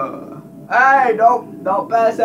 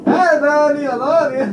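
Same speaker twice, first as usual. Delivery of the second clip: mock sweet talk; 9 semitones higher, 3 dB louder than usual. Sweet talking to girlfriend.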